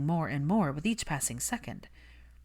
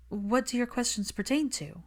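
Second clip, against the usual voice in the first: careful question; 7 semitones higher than usual. Acted. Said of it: "the most careful question".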